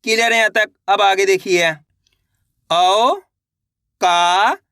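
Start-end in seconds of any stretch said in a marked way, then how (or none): 2.7-4.6 औकात drawn out very slowly, each syllable stretched, rising at the end; teacher sounding out औकात for a child; sounded out slowly. Shown as a demonstration. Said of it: "तो मिलके बन जाएगा".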